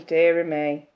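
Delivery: normal talking voice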